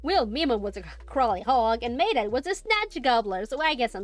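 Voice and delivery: light Australian creature merchant; chatty Australian trader, lightly voiced; Australian accent. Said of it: "the Australian accent".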